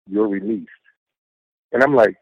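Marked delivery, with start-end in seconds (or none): 0.1-0.7 soft, breathy-quiet retelling of the words; hushed final release. Retold or imitated. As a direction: drop to a near-hushed, flat tone. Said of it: "it's just the way she said it".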